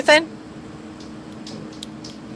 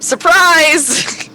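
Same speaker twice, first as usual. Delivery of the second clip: drawn-out surprise; 8 semitones higher than usual. Pretend. Bursts through the door.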